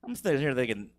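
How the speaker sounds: ordinary talk, nothing marked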